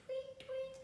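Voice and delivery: very high, soft chirping; birdsong. A narrator's reading. Bird at dawn, high soft tweet. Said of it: "went the bird".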